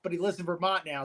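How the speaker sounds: normal talking voice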